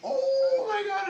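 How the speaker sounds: high, slow pained cry; stinging pain. Real, not acted. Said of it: "it stings".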